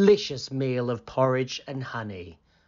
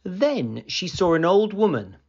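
ordinary delivery, nothing marked

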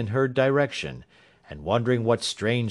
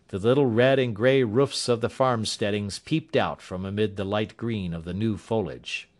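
plain reading voice

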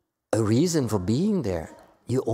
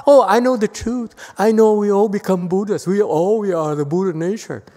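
higher, louder mimicry of smug disciples; mocking quote; 7 semitones higher, 7 dB louder than usual. Teacher mocks disciples who boast of the truth, loud and high. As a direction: parody them, pleased with themselves. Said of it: "they talk".

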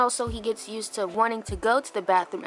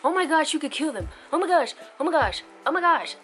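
high, louder mock panic; mocking fussy pet people; 6 semitones higher, 6 dB louder, faster than usual. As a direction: imitate an overanxious scold, repeating in alarm. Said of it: "the people who try to tell you".

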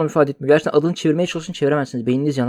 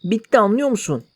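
laughing; 7 semitones higher than usual. Real, not acted. Laughing while voicing character.

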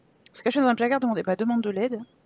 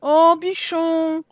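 cooing pet name; 3 semitones higher, 3 dB louder, much slower than usual. Pretend. Cooing at a Sim.

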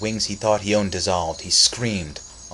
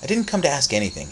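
hurried denial; 7 semitones higher, faster than usual. Acted. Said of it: "he said".

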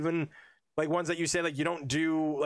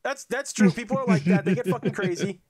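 louder, mock-earnest insistence; mock-serious agreement; 5 semitones higher, 6 dB louder than usual. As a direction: insist it's true with a half-joking punch. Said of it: "I was like".

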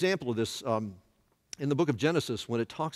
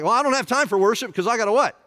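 loud, high mock excuse; mock busy pastor; 8 semitones higher, 8 dB louder, faster than usual. Pastor making excuses to skip worship, hammed-up and loud. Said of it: "We were like".

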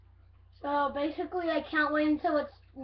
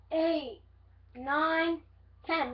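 ordinary talk

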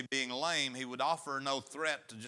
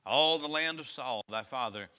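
louder, measured Bible reading; solemn pulpit reading; scripture reading; 6 dB louder than usual. Acted. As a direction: read the verse out to the congregation. Reads scripture aloud.

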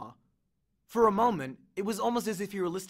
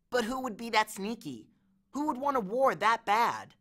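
put-on higher-pitched, puzzled young satyr voice; mystified Grover; 6 semitones higher than usual. Grover wonders who wants a war, high and mystified. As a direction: ask it high and baffled.

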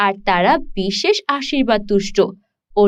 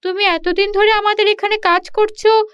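higher, surprised lady of the house; landlord's wife; 8 semitones higher than usual. Acted. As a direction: demand to know where he is going. Landlord's wife questions shepherd. (উঁচু গলায় অবাক জমিদার গিন্নি)

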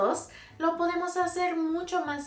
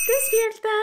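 high, loud call to wake up; 12 semitones higher, 8 dB louder than usual. Acted. Teacher calls the pretend sleepers to wake up, loud. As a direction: jolt them awake.

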